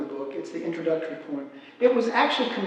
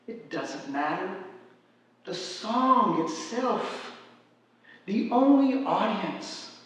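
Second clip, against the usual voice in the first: higher, deliberate verse recital with drawn-out key words; poetry reading; 4 semitones higher, slower than usual. Acted. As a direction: lyrical poem read aloud, spaced by pauses. Reads own poem aloud.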